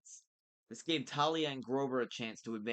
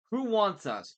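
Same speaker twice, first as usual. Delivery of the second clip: loud, high-pitched demand; 11 semitones higher, 11 dB louder than usual. A narrator's reading. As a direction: demand an answer forcefully. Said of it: "Bianca demanded".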